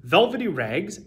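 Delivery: plain reading voice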